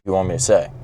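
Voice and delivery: usual speaking voice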